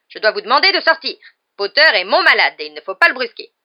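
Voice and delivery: loud, indignant order; angry nurse. A narrator's reading. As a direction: scold visitors out of the ward. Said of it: "s'écria madame Pomme Fraîche avec colère". (avec colère)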